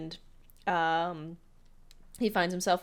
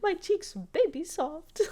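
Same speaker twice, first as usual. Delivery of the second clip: much higher put-on giggly child; giggly kid voice; 13 semitones higher than usual. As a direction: squeak it like a pampered kid. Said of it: "He's like".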